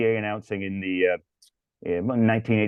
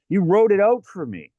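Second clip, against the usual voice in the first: ordinary talk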